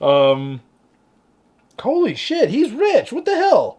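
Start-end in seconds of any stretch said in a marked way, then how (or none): 1.8-3.8 loud, high exclamation of disbelief; mock astonishment. Players reacting to a friend's hidden riches, astonished. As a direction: blurt it high and loud, astounded.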